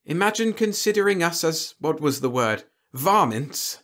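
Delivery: high opening, mock incredulity; amused disbelief without a real laugh; amused alien. A narrator's reading. Alien scoffing at being taken for vermin, lightly amused. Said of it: "He laughed scratchily".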